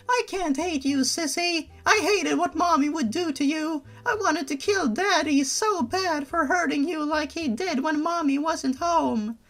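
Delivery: put-on high pleading little-girl voice; a small child begging her sister not to leave; high childish voice, pleading; Benna voice. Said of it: "This is my Benna voice from now on".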